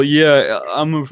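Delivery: normal talking voice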